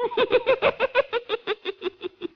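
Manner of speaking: long cackle trailing off; sly, rapid chuckle dying away; gloating witch cackle. Acted. Witch gloats over sly plan.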